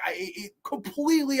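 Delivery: normal talking voice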